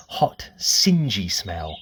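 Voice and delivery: ordinary delivery, nothing marked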